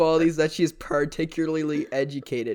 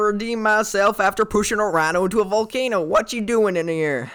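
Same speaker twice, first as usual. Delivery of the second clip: exaggerated Southern accent; 6 semitones higher than usual. Improvised woman confronting an intruder, high hammed-up Southern accent. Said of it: "Why did you become all so Southern?".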